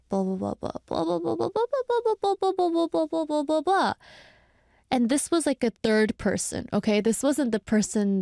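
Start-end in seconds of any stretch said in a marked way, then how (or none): 0.1-3.9 high mocking blah-blah of an angry rant; hammed-up, sing-song imitation of someone ranting. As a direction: parody their raging as empty noise. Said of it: "they were, like, raging".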